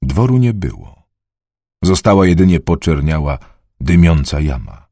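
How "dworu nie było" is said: ordinary delivery, nothing marked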